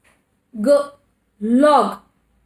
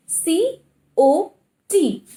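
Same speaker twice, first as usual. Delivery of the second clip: C O T sounded out letter by letter, slowly; teacher sounding out the letters of a word; 2 semitones higher, much slower than usual. Shown as a demonstration. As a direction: stretch out each sound slowly. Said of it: "how we are going to pronounce it?".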